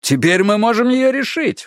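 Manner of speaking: high, eager burst; excited exclaim. A narrator's reading. Woody exclaims to his siblings, excited and high. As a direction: cry out with sudden triumph. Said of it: "воскликнул Вуди".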